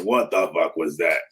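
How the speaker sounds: low, soft imitated rasp; raspy voice. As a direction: impersonate a raspy-voiced teammate. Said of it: "he had this real raspy voice".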